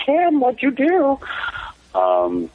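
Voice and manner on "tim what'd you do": high, drawn-out mock mom voice; slow, very high-pitched impression of a shocked mother; imitated mom. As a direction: put on mom's high voice, stretching the words. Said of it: "and she'd go".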